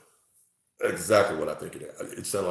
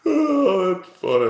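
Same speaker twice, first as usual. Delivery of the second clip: laughing; 11 semitones higher, 11 dB louder, slower than usual. Real, not acted. Burst of laughter.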